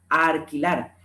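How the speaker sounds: ALQUILAR said as arquilar; rhotacism, "arquilar". Shown as a demonstration. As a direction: put an R in place of the L in "alquilar". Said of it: "Pronunciación de la R en lugar de la L".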